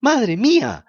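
high, loud startled cry; frightened cry. Acted. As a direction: cry out in terror. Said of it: "gritó asustada la marioneta".

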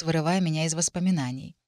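plain reading voice

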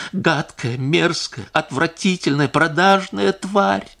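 rising to a slow, spat final curse; venomous insults. A narrator's reading. Wife reviling her husband's mistress, in disgust. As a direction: spit out each insult in disgust.

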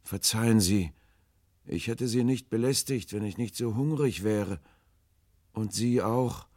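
high, mournful call; sad and hopeless. A narrator's reading. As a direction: apologise forlornly from a distance. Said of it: "Sie klang unendlich traurig und hoffnungslos".

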